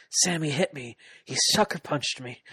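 hammed-up wounded whine; whiny victim. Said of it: "He's just like".

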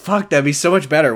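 ordinary talk, nothing marked

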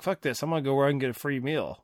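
ordinary talk

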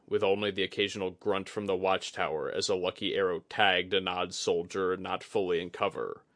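ordinary delivery

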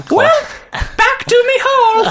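squeaky, slow through laughter; high laughing. Real, not acted. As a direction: laugh the words out. Joke exit, laughing.

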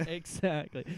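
ordinary talk

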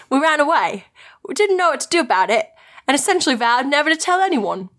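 uncertain, put-on English accent; attempted English dialect. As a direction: read it in a wobbly amateur English accent. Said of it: "I don't know what this accent is".

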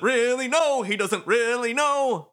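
high, loud goofy showman; flamboyant showman voice. Acted. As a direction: ham it up. Voices Motoi.

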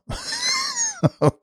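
soft, very high laugh; high wheezy chuckle. Real, not acted. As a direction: let a squeaky little laugh slip out. Amused after describing [name].

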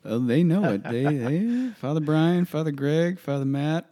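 loud and high, mock-indignant listing; excited teasing. Real, not acted. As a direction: pile on the names with playful outrage. Rising teasing about pastors' pride.

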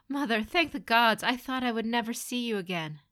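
high, loud rush of relief; overjoyed relief. Acted. Son running to his lost mother, overjoyed. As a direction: cry out with joy, easing down by the end.

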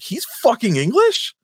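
high, slow retold disbelief; incredulous. Retelling finding out the actor is English, mock incredulous. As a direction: replay your shock, pitch shooting up. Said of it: "I was like".

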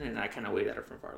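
ordinary talk, nothing marked

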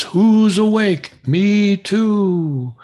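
high, slow hooting owl voice; great horned owl call. Retold or imitated. Imitating a great horned owl for a caller, high slow hoot. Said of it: "the great horned owl says".

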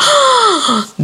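high, theatrical gasp; shocked gasp. Acted. Teacher seeing a boy punched in class, gasping. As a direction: suck in air in mock horror.

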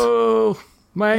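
put-on high, drawn out old man groan; old guy voice. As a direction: complain like a cranky old man. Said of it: "My old guy voice".

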